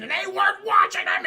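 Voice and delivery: high, loud raging shout; hammed-up shouted accusation; mock furious outburst. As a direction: imitate an unhinged mother erupting. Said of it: "she starts shaking Alice".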